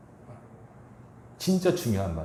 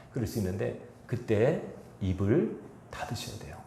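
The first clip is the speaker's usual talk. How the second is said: normal talking voice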